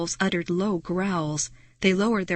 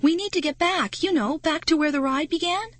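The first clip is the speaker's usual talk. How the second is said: higher, urgent asking; anxious plea; 8 semitones higher than usual. Acted. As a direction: ask the werewolf for help anxiously. Pleading with werewolf.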